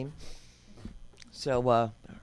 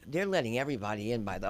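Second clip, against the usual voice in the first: ordinary delivery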